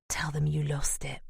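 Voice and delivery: hushed, breathy whisper. Acted. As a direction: whisper it like a secret. Secret instruction before going inside.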